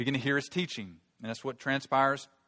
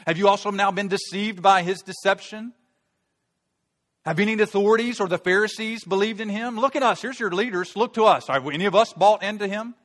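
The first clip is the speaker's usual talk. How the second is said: loud, high, scornful challenge; haughty Pharisees; 8 semitones higher, 10 dB louder than usual. Acted. Pharisees berating their own officers, loud and haughty. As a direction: sneer down at them from your authority. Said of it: "And the Pharisees answered them".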